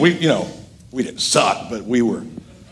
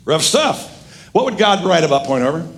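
ordinary talk

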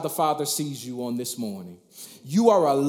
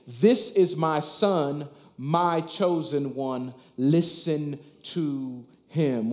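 slow solemn reading; slower than usual. Acted. Preacher voices God from the cloud.

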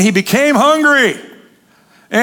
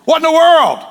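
much higher, loud startled cry; astonished; 8 semitones higher, 3 dB louder than usual. Acted. Baptist at devotions sees God appear, astonished cry. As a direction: gasp it out in amazement.

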